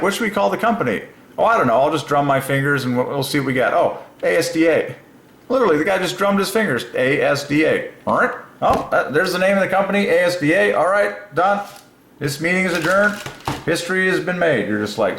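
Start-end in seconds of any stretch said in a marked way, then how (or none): 0.0-1.0 high, fast mock boardroom voice; mock executive. Mocking the founders' meeting, high-voiced executive. 1.4-3.7 high, careless mock boss; mock executive. Mocking the founders' meeting, high-voiced executive. 3.7-4.9 mock executive. 5.5-14.7 high, fast amused ranting; giddy mockery. Real, not acted. To viewers, mocking the ASDA boardroom, gleefully high.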